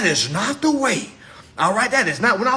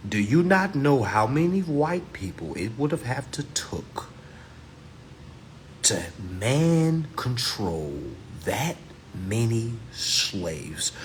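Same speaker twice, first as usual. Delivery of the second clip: breathy hush, slowing to a crawl; hushed whisper; 9 semitones lower, 5 dB quieter, slower than usual. Real, not acted. Asking the viewers in disbelief, whispered. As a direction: whisper it slow, like a secret.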